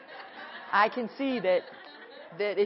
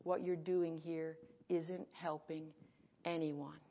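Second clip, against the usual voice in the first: quiet accusing thought; 4 semitones lower, 10 dB quieter, slower than usual. Retold or imitated. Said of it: "that come through my mind".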